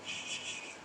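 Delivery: hushed, breathy shushing to a dying dad; whispered shh to calm the father in hospital; soothing shush. Retold or imitated. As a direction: gently hush him like a child. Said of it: "and I'd just go".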